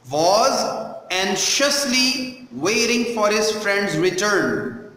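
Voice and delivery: slow, with "anxiously" set apart word by word; slow pronunciation model. Shown as a demonstration. Teacher repeating the line for pupils, slow and careful on "anxiously". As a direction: spell out "Was anxiously waiting" slowly, word by word. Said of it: "how I am pronouncing each word".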